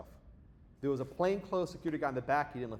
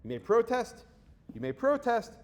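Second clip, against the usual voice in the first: raised, louder lecturing voice, higher; retold administrator addressing crowd; 4 semitones higher, 6 dB louder than usual. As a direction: imitate the administrator calling the room to order, loud.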